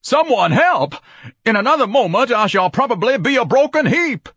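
high, loud, pompous panic; hysterical, grandiose alarm; crying out. A narrator's reading. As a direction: wail for rescue melodramatically. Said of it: "Ignatius cried".

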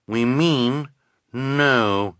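lightly voiced henchwoman. A narrator's reading.

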